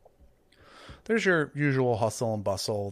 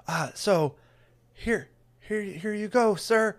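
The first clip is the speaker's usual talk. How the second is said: higher, stammering, nervous helper; flustered Trevor; 6 semitones higher, 5 dB louder than usual. Acted. Trevor flustered by the huge cleric, higher and stammering. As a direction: babble nervously up in pitch. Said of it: "and Trevor's just like".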